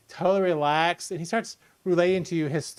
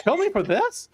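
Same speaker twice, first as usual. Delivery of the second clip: much higher and louder, disbelieving; shocked; 13 semitones higher, 3 dB louder than usual. Acted. Bard shocked at the threat to kill him. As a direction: thrown back in sudden disbelief. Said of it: "He seems shocked".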